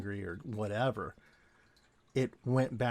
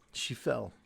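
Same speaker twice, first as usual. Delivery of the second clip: usual speaking voice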